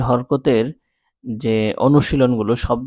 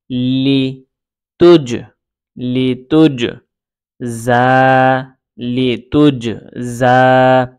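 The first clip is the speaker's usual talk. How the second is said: slow, held syllables building into a word; measured teaching chant; syllable-by-syllable reading; much slower than usual. Shown as a demonstration. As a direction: sound out pieces, then join them. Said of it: "আমি দুইভাবে পড়ে".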